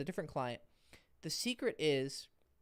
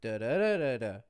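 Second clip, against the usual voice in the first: even da-da rhythm; 6 semitones lower than usual. Shown as a demonstration. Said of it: "my cadence, the timing is the same".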